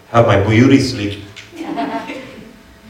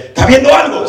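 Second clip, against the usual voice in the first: sharply higher, louder question; startled question; 14 semitones higher, 4 dB louder than usual. Retold or imitated. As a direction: ask it alarmed in the dark. Pastor asking in the dark.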